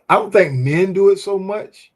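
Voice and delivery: ordinary talk, nothing marked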